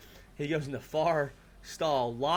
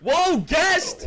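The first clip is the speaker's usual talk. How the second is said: higher and louder, whooping welcome; excited shout; 10 semitones higher, 5 dB louder than usual. Real, not acted. Hosts welcoming the first guest with a loud hyped shout. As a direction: hype up the arriving guest.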